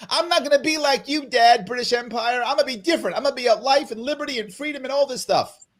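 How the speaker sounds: put-on high, defiant teenage voice; rebellious son to his father. Rebellious young nation defying its father, in a high defiant voice. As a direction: talk back to Dad like a rebellious kid. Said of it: "we were the rebellious youth".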